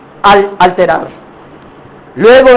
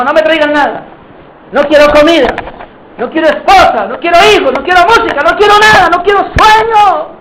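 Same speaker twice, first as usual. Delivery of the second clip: higher, mounting petulant refusals; distraught refusal; 6 semitones higher than usual. Acted. Sleepless king turning away food, music and sleep, increasingly distraught. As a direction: refuse each thing more insistently, climbing in pitch.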